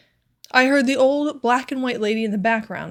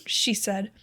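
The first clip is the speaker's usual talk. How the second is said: ordinary delivery, nothing marked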